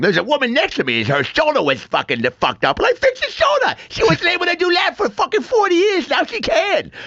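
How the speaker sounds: high-pitched impression, climbing into shouted boasting; Brian impression. Comedian retelling the brother's plane story, high shouted impression. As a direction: imitate an excitable brother, voice pushed way up high. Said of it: "that's who I was doing the voice of".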